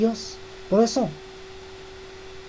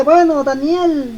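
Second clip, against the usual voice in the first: a frantic king calling down to Daniel; desperate cry; 6 semitones higher, 5 dB louder than usual. Acted. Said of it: "y grita, y desesperado".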